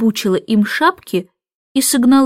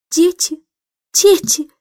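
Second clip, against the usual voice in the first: despairing call; 6 semitones higher, slower than usual. A narrator's reading. Mother despairs at kids.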